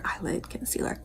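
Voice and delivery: quiet self-correcting mutter; muttered whisper. Real, not acted. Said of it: "whispered repeat".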